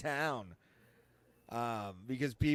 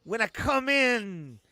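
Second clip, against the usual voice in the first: wrestling promo; 7 semitones higher, 7 dB louder than usual. Pretend. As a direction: cut a cocky wrestling promo. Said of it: "You guys should do a wrestling promo video".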